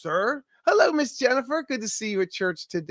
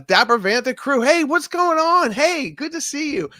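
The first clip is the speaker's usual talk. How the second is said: excited greeting; 10 semitones higher, 5 dB louder than usual. Real, not acted. Greeting a friend crew in chat.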